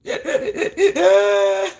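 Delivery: half-laughing sobbing wail; mock bawling. Shown as a demonstration. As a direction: show what the bawling would sound like. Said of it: "That type".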